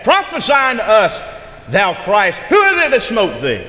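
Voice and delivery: high, loud jeering challenge; mocking taunt. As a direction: call it out as a mocker taunting a captive. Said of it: "Mocking him".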